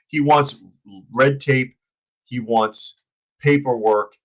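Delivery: ordinary talk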